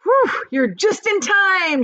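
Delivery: high, loud, slow relieved shout; excited shout. Acted. Grandpa Joe to Charlie, relieved and excited shout. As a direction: cry out in relief. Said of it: "shouted Grandpa Joe".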